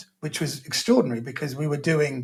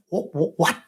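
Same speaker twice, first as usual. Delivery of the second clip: thrown, wobbling disbelief; slower than usual. Retold or imitated. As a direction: stammer out broken, baffled whats. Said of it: "he had this real wobble".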